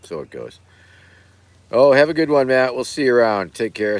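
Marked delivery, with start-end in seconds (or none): 1.7-4.0 cheery greeting. Real, not acted. Greeting a viewer by name.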